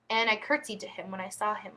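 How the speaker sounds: usual speaking voice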